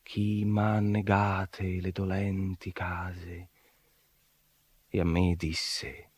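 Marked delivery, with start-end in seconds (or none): 0.0-3.5 slow, whispered lament; sighing whisper. A narrator's reading. Master turned away from the gate, sighing whisper. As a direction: breathe it out in dejection. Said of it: "e dicea nei sospiri".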